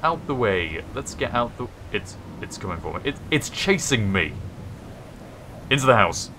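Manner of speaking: panicked, rising yelps; scared horror game streamer. Real, not acted. Sandstorm chasing the player.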